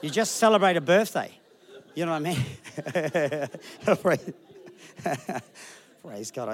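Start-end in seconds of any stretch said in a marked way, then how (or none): 1.9-6.5 laughing through the words, fading off. Real, not acted. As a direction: let the laugh take over.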